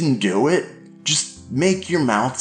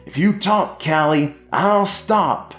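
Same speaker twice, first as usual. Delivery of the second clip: shouting dad; 12 semitones higher than usual. A narrator's reading. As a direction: shout at her while hitting him.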